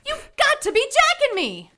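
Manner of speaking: high, incredulous outcry; can't believe it, pitch shooting up; alarmed protest. Acted. Poppy hearing Harry order her back into the shed, incredulous.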